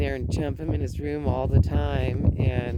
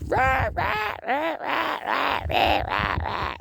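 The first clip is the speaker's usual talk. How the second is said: imitating grandson's babble; 10 semitones higher than usual. Grandmother showing how her wordless grandson talks, high imitated babble. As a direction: babble in a high roar like a little dinosaur. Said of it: "Like, that, that's how he talks".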